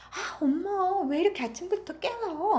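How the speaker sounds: high, loud whiny complaint; spoiled girl shrieking in protest; whiny angry daughter. Acted. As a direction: whine at mom for waking her early. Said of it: "하면서 막 화를 냈네요".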